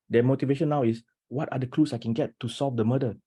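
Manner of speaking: normal talking voice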